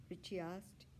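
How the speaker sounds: usual speaking voice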